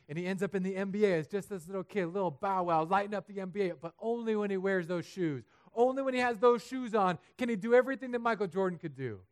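climbing sermon energy; building excitement. Real, not acted. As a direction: build it up like a hype man. Preacher builds excitedly about movie.

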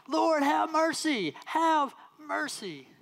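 very high, slow, desperate plea. Acted. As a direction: cry out even harder, stretching each word. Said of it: "they only shouted louder".

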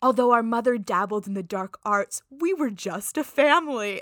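put-on a light, lofty British accent, not quite held; half-hearted British accent quoting a flashback line; mock British accent. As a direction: imitate Elijah's stiff British accent. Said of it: "I wish I could do a British accent".

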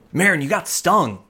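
high, squeaky little kobold voice; a small kobold, thrilled she woke up; excited kobold. Acted. Kobold Sorrel again.

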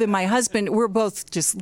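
usual speaking voice

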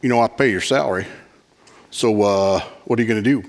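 mock a pushy member who pays your salary; demanding churchgoer. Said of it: "this is what I would hear".

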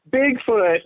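playful mock yell. Podcast guest imagines friends yelling at drunken Bigfoots, mock yell. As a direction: call it out like a pretend group holler. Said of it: "then we all yell".

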